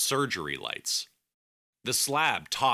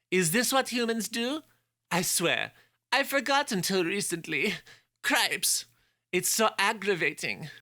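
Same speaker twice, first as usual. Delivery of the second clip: put-on very high, irritated nasal French accent; 12 semitones higher than usual. The doctor rants about humans.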